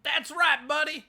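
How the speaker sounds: imitating cheering guy. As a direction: mimic the guy cheering along at the rally. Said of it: "a guy cheering along that just said".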